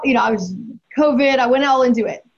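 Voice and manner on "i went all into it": ordinary talk, nothing marked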